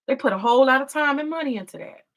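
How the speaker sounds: usual speaking voice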